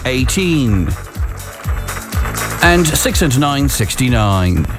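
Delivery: bingo caller. Real, not acted. Bingo numbers called on radio.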